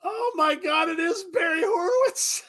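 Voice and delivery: high, loud incredulous shouts; shocked disbelief. Real, not acted. Watching wrestling with a friend, shrieking the name in disbelief.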